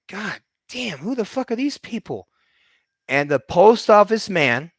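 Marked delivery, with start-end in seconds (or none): 0.1-2.3 amazed. Retold or imitated. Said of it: "And I was like".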